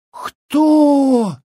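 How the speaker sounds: very high, long drawn-out question; astonished. A narrator's reading. Village women baffled by the strange word, astonished. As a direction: stretch it high in disbelief. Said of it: "изумились бабы".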